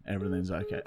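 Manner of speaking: ordinary delivery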